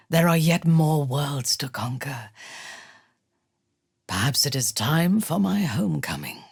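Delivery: ordinary delivery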